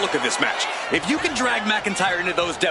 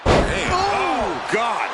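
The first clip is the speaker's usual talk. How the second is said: surprised; 7 semitones higher, much slower than usual. Real, not acted. Reacting to a crash.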